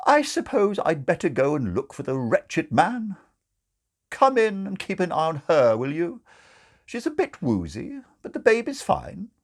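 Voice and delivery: high, brisk matron. Acted. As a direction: bustle and give orders. Sister Grace ordering.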